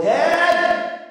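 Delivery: put-on high, drawn-out kid call; little boy calling. Little boy calls dad five minutes later, high mock yell. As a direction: stretch it out like a child wanting attention. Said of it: "the little boy".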